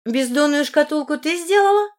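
high, rising demand; interrogating princess. A narrator's reading. Сильвана questioning the heroine about the casket, demanding. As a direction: question her in a high, pressing voice.

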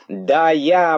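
высокий, громкий задорный возглас; выкрикни дерзко, высоко и громко; дерзкий выкрик. A narrator's reading. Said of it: "воскликнул дерзкий кролик". (дерзко, с вызовом)

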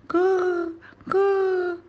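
high-pitched drawn-out coos; baby cooing. A narrator's reading. Baby brother babbling happily at Joud, cooing. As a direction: gurgle high like a happy infant. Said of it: "ويكاغي".

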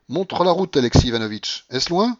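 high, loud impatient order; commanding. A narrator's reading. Grandmother asking companion to show the road, commanding. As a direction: press them to lead the way.

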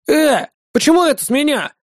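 high-pitched indignant protest. A narrator's reading. Octopus protests being asked to pay, indignant. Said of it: "возмутился он".

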